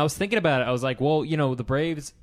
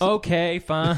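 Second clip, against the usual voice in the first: grudging okay; 7 semitones higher, 6 dB louder, slower than usual. Pretend. Mocks reluctant agreement.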